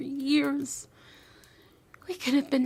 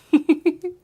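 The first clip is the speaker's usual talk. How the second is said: short giggle, louder than the whispers; bright playful giggle; 4 semitones higher, 10 dB louder than usual. Acted. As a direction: let a delighted giggle slip out. Playful laugh after teasing.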